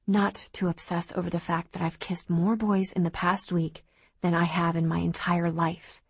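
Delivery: ordinary delivery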